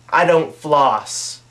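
flat confession. Retold or imitated. To the dentist, a slow deadpan confession. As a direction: drag out a blunt I don't floss. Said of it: "My answer's always the same".

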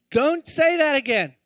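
loud, high, slow mock warning; acted telling-off, each word leaned on; stern scolding. Owner scolding the parrot after hitting its beak, stern.

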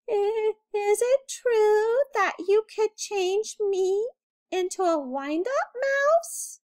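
high, slow, trembling mouse voice; timidly asking the great lizard; quivering voice. A narrator's reading. Frightened mouse asking the magic lizard a favor, quivering. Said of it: "in a quivering voice".

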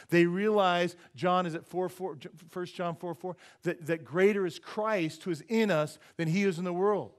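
loud, high preaching, building the point; rising pulpit proclamation; full-force preaching. Acted. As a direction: preach it out to the whole room.

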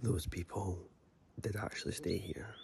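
very soft, low murmur; hushed. Real, not acted. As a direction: keep it down so nobody wakes up. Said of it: "don't want to speak too loud".

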